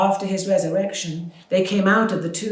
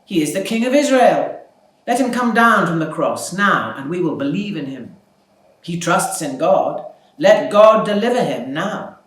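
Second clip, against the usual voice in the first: loud scornful jeering, higher; mocking taunt; 4 semitones higher, 9 dB louder than usual. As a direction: jeer it loudly, relishing his helplessness. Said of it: "were mocking him".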